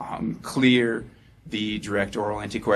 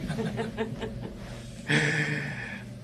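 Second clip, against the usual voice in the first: a breathy laugh at her own slip; embarrassed laugh; 7 semitones higher, 5 dB quieter than usual. Real, not acted. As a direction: laugh it off, self-conscious. Laughs at own stumble on drug name.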